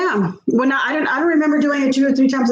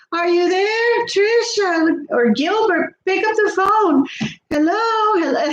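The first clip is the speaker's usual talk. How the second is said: higher, slow calling voice on an answering machine; mother-in-law's message; 7 semitones higher, slower than usual. Retold or imitated. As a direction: call out to an empty house, higher and slow. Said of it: "It was her".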